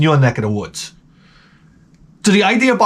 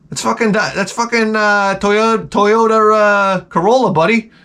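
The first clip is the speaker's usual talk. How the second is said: higher, mocking car-guy voice; lightly voiced sneering car buddy; 6 semitones higher than usual. Comic mocking a car owner's bragging, lightly voiced.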